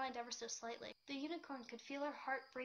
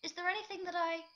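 higher, louder, bolder offer of help; a friend steadying herself to help; gaining confidence; 6 semitones higher, 9 dB louder than usual. A narrator's reading. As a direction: push forward with new confidence, then cut off. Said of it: "her voice gaining confidence".

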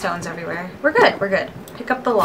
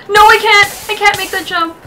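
high, loud panicked cry; panicked yell; 11 semitones higher, 11 dB louder than usual. Real, not acted. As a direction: shriek it in alarm. Panic at jump.

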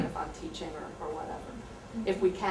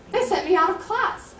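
put-on much higher, louder indignant kid voice; protesting student; 10 semitones higher, 6 dB louder than usual. Teacher imitating a student sent to choices, indignant and high. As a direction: play the upset kid who feels punished.

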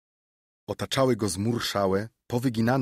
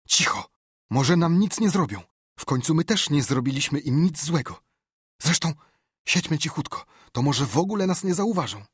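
high-pitched, raised, frightened voice; anxious urgency; 7 semitones higher, 5 dB louder than usual. A narrator's reading. Tom urging Huck to hide from devils, frightened urgency. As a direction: talk yourself out of panic, urgently. Frightened boy hoping to go unnoticed.

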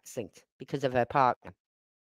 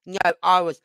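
usual speaking voice

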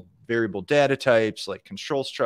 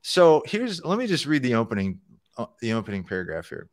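ordinary talk

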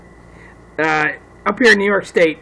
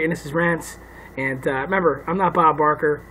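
ordinary talk, nothing marked

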